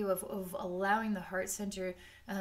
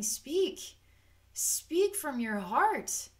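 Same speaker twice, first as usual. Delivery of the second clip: high, slow, solemn call to speak; voice of the soul; 6 semitones higher, 4 dB louder, much slower than usual. Pretend. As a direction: draw each word out like a calling spirit. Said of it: "And your soul essence is saying".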